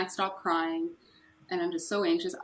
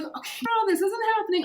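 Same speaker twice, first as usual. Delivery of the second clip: very high-pitched retold wail; imitated bawling; 14 semitones higher, 3 dB louder than usual. Speaker re-enacting their meltdown to coworkers at work, mock bawling. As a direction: act out a meltdown, voice shooting up high. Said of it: "I start bawling".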